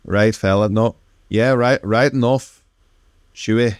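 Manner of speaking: light Manx accent, flat and Irish-tinged; a hint of an older Manx man chatting; lightly voiced Manx Irish-leaning lilt. Shown as a demonstration. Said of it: "more Irish sort of".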